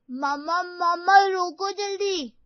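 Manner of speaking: put-on child's high urgent yell; shouting for uncle. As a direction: yell at uncle to stop the bike. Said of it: "वो चिल्लाने लगता है". (Bike पर मामा को रोकने के लिए चिल्लाता बच्चा)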